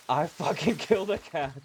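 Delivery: half-horrified giggle at her own kill; laughing disbelief. Retold or imitated. Streamer laughing that she thinks she killed a cat. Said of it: "For a moment I was like".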